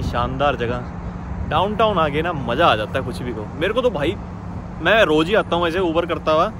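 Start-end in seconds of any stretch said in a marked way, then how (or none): none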